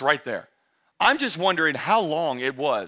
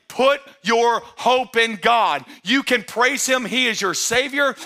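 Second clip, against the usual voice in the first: higher, louder, slow sermon call; preaching at full force; 5 semitones higher, 4 dB louder, slower than usual. Real, not acted. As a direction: declare it over the church.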